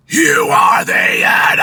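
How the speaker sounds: mid scream. Shown as a demonstration. Coach demonstrating the phrase as a mid scream. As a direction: belt the phrase harshly and hold the end. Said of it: "as a mid scream".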